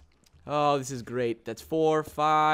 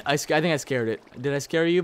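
usual speaking voice

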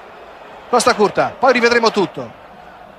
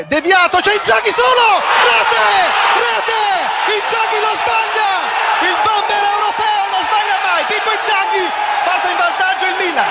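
much higher shouted goal call; 9 semitones higher than usual. Real, not acted. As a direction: scream the goal with full excitement.

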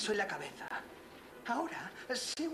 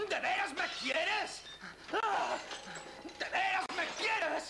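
higher, slow sneering question; mocking question; 8 semitones higher than usual. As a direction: sneer it at her. Son mocks mother's love.

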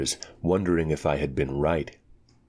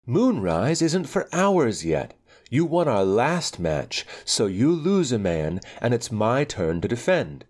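put-on spoiled girl; 6 semitones higher than usual. Anika voiced, very high.

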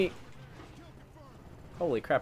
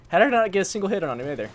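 loud, fast and higher, exasperated; frustrated rant; 7 semitones higher, 9 dB louder, much faster than usual. Real, not acted. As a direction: rattle it off in disbelief.

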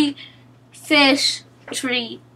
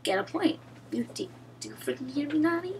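muttered; 16 dB quieter, faster than usual. Real, not acted. Yelling then muttering at teammate.

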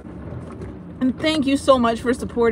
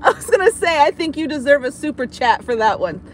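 higher, words squeezed out through helpless laughter; can barely talk for laughing; laughing hard; 9 semitones higher than usual. Real, not acted. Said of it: "I'm laughing too hard".

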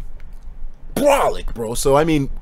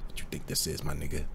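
hushed; 12 semitones lower, 16 dB quieter than usual. Real, not acted. Mock-offended at the chat.

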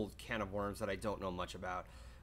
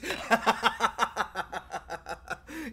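high rising giggle fit; real giggling; high laugh; 12 semitones higher, 6 dB louder than usual. Real, not acted. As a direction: laugh helplessly. Laughing at pottery remark.

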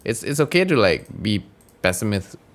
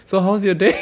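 sweet polite; 10 semitones higher, 3 dB louder than usual. Retold or imitated. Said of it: "we both go like".

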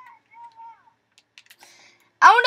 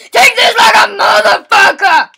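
loud taunt; 4 semitones higher, 5 dB louder than usual. Real, not acted. Louder repeat of taunt.

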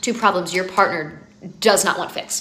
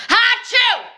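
loud, high exaggerated achoo; mock sneeze; 13 semitones higher, 6 dB louder than usual. Wife mocking husband's loud sneeze, hammed up. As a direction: blast out a cartoonish sneeze.

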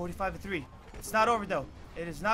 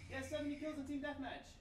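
high-pitched, softer rush of joy; breathless excitement; 9 semitones higher, 9 dB quieter than usual. Real, not acted. Gamer telling his mom about the challenge, high and excited. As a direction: gush the news.

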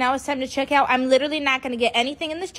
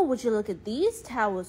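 ordinary talk

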